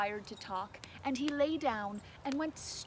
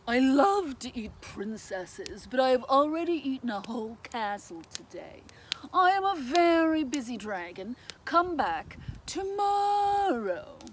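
big, loud dragon voice with long drawn-out words; loud, grand storybook dragon; busy, dismissive dragon; 8 dB louder than usual. A narrator's reading. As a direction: boom it out slowly like a dragon turning someone away. Dragon speaks in read-aloud story.

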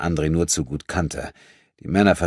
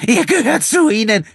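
very high, loud shriek; shrill scream; 10 dB louder than usual. Acted. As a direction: shriek it in hatred. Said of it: "schrie die Frau". (schriller Schrei)

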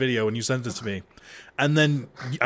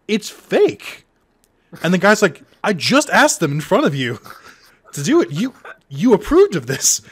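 raised defensive protest; 6 semitones higher, 8 dB louder than usual. Retold or imitated. Quoting the defensive guy.

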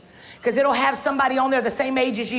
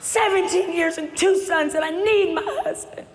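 pleading with God; 5 semitones higher than usual. Retold or imitated. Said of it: "I was screaming out to God".

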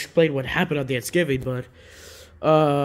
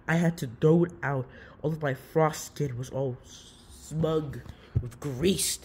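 usual speaking voice

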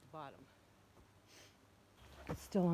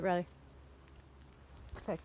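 ordinary talk

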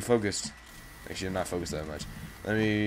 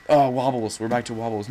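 ordinary talk

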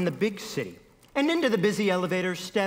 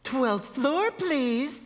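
higher-pitched woman's voice; pregnant woman; 6 semitones higher, much slower than usual. A narrator's reading. Pregnant woman to the elevator operator, higher woman's voice. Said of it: "said the pregnant woman".

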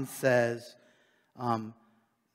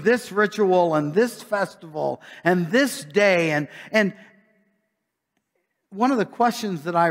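raised, driving preacher voice, a bit louder; lively preaching; 4 semitones higher, 6 dB louder than usual. Acted. Preacher challenging the congregation, a touch louder and driving. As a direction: press the point home to the flock.

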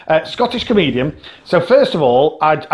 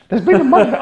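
higher, mock Glaswegian "There's been a murder"; Scottish "There's been a murder", a bit louder and higher; Scottish accent; 8 semitones higher, 3 dB louder, faster than usual. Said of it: "that accent out of that face".

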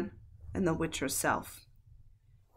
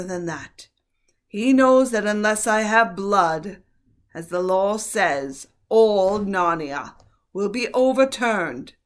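slow, loud menacing witch; 3 semitones higher, 8 dB louder than usual. A narrator's reading. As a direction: threaten coldly and deliberately. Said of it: "said the witch".

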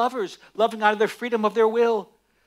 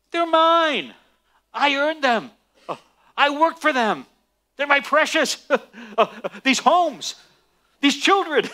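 possessive grasping voice; 5 semitones higher than usual. Acted. Preacher voicing a grasping man protesting to God, greedy and indignant. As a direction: whine over your precious things. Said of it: "he doesn't say to God".

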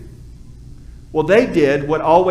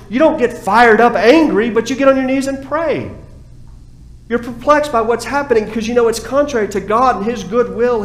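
normal talking voice

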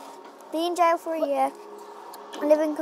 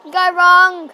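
loud teasing; 4 semitones higher, 14 dB louder than usual. Real, not acted. Teasing the loser.

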